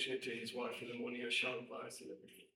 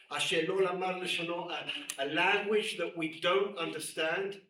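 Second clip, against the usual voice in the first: prophetic preaching; 4 dB louder than usual. Shown as a demonstration. Preacher proclaiming the rabbi's prophecy to the congregation.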